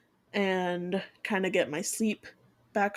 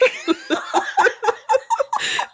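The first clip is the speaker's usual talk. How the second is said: squealing laugh, very high; 5 dB louder than usual. Real, not acted. Laughing at own hype.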